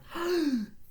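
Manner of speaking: high, hammed-up gasp of fright; playful fake gasp; mock gasp. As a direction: gasp as if a fraction scared you. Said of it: "I am so afraid of fractions".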